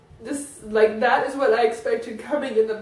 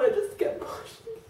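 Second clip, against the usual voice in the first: breathless tearful whisper, much softer; 7 semitones higher, 7 dB quieter than usual. Real, not acted. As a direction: barely get it out, in a whisper. Confessing her fault, crying.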